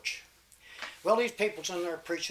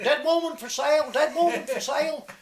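loud, high street hawker's cry; hollering; 9 semitones higher, 7 dB louder than usual. Acted. Big Claude hawking his dead grandma through town, loud high cry. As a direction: call it out across town to sell. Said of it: "went down through town and hollered".

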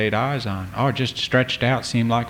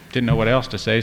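ordinary delivery, nothing marked

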